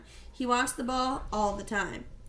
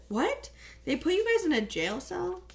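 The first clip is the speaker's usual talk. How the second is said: pitched up in disbelief; shocked question, higher; 8 semitones higher than usual. Acted. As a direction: gasp it out, high and stunned. Shocked reaction to jail news.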